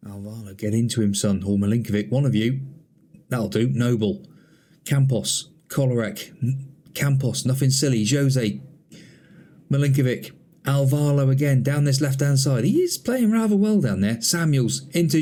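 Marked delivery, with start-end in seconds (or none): none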